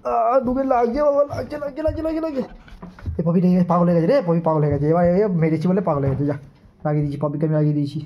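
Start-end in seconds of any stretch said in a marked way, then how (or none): 0.0-2.4 mock ouch. Mock pain while playing with dog.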